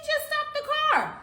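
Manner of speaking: very high-pitched mock disbelief; incredulous echo. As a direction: throw the order back in a squeak. Echoes cousin's order in disbelief.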